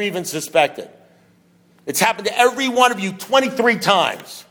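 forceful, driving rhetorical questions; fervent preacher building a relentless challenge; impassioned preaching. Real, not acted. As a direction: press the room hard, incredulous.

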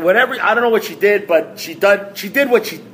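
normal talking voice

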